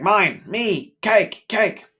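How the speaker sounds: put-on high, loud toddler demanding cake. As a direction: chant it like a greedy little kid grabbing for cake. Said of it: "not that he has full words".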